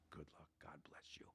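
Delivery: whispered, low blessing; nun's hushed blessing. Retold or imitated. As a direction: imitate a nun whispering to a hopeless student. Retells the nun's grave blessing.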